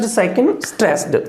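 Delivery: usual speaking voice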